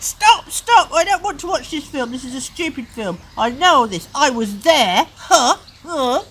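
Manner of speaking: put-on loud, high, furious child shouting; angry yelling. Parent voicing Peter yelling to stop the film, angry yelling. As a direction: yell at the telly to stop.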